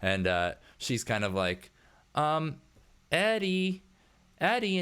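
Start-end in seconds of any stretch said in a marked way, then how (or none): 2.1-4.8 high, hesitant call for attention; calling out tentatively. Acted. Worker calling her boss who isn't responding, high tentative call. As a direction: try to get his attention, pitched well up.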